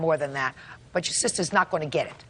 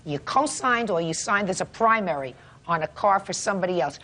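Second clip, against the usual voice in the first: usual speaking voice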